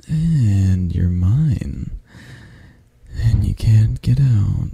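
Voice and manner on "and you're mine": slow possessive. Acted. Possessive cuddle moment.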